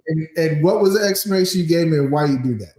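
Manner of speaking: usual speaking voice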